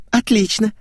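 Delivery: put-on high, bright girlish cheer; noticeable joy. A narrator's reading. Girl welcomes the fuel stop, high joyful voice. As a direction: chirp it gladly, pitched high. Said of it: "с заметной радостью отозвалась Светик".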